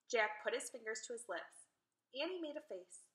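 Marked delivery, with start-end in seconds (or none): none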